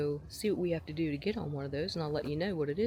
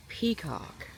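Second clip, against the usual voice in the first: usual speaking voice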